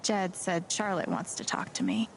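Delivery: usual speaking voice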